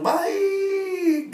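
high, long drawn-out chorus cry; crowd's chorus answer. Retold or imitated. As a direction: imitate a whole crowd answering in one sustained high note. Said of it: "semua bilang".

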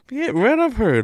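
loud, high burst of exasperation; sudden loud exasperated cry; exasperated outburst. Retold or imitated. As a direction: blurt it out like you're fed up with her. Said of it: "I'm like".

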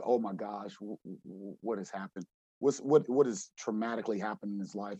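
low, soft, halting worry; hushed concern. Retold or imitated. Coach seeing his player crying in the dark, hushed concern. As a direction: drop the voice, stumbling over the question.

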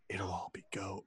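low, soft, deadpan aside; Dwight impression. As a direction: mutter it to the camera. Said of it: "he's like".

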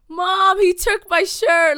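put-on high, slow, whiny child; whiny little kid. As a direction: tattle to mom like a kid. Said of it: "he sounded like a".

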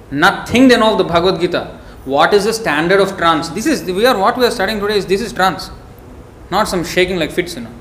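ordinary talk

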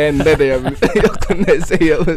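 joking about only knowing sandwich Swedish, laughing. Real, not acted. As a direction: laugh at yourself. Laughs at his own poor Swedish.

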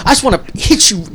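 high, loud mock fury; imitated angry threat. Imitates customer threatening to hit him.

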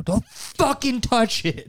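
high, slow mock warning to coworkers; hammed-up slow threat. Friend suggesting a note to coworkers, slow high mock threat.

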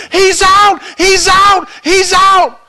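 very high, loud repeated calls; acted opposing team yelling. Other team disputing the play at home, yelling. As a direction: yell back at the other team. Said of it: "The other team is yelling".

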